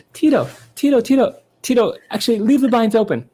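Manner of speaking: very high put-on star voice. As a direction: call to a brother in a high mock voice. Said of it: "He's going".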